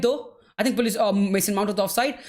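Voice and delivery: ordinary talk, nothing marked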